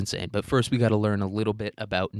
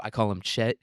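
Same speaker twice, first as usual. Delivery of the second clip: ordinary talk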